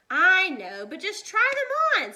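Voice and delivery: high, loud insistent plea; insistent urging. A narrator's reading. Annie pressing Jack to try the boots, loud insistent. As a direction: wave off his worry and insist.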